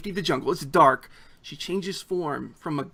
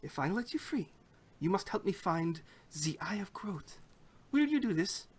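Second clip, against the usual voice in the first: put-on soft, higher accented woman's voice; bargaining goblin guard; 3 semitones higher, 8 dB quieter than usual. Goblin guard bargains with captive for freedom, hushed.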